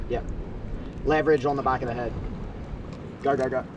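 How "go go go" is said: ordinary delivery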